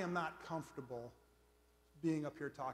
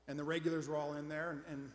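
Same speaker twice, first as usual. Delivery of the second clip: ordinary talk, nothing marked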